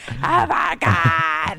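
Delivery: very slow, drawn-out fan freak-out. Retold or imitated. Fan re-enacting her reaction to the trailer, freaking out slowly. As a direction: stretch every word in overwhelmed delight. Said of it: "I'm freaking out".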